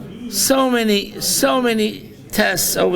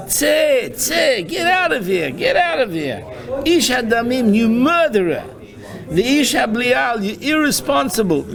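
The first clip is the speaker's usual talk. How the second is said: higher, harsh cursing cry at the king; Shimei's angry curse; 4 semitones higher than usual. Acted. Shimei cursing the fleeing King David, angry high cursing. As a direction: hurl curses at a fallen king. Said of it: "This is what Shimi, the Gdol Hador, is saying".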